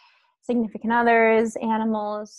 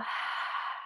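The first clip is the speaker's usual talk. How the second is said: long unvoiced sigh out; releasing exhale; 11 dB quieter than usual. Shown as a demonstration. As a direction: let all the breath go in one airy sigh. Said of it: "let the breath go".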